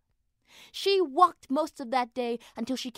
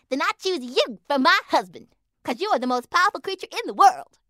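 eager little mouse; 6 semitones higher, 7 dB louder, faster than usual. A narrator's reading. Lana mouse speaking.

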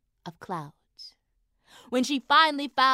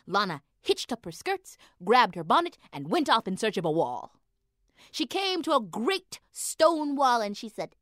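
ordinary delivery, nothing marked

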